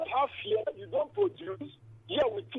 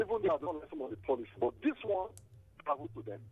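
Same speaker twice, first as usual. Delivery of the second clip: barely audible; 8 semitones lower, 5 dB quieter than usual. Real, not acted. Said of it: "Divine, your voice is very faint".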